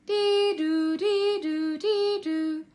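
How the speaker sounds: a fire engine siren, sung out; siren noise. Pretend. Boy voices the arriving fire engines as a siren.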